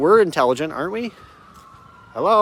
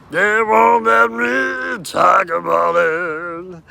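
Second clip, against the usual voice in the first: drawn-out, louder, half-sung complaint; slow mock shout; 3 semitones higher, 4 dB louder, much slower than usual. Complaining to the camera about being silenced, slow shout. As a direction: stretch every word and belt it.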